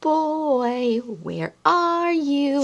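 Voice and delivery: playful hide-and-seek voice; reading to children. Acted. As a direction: coax the child to look. Reading picture book to toddler.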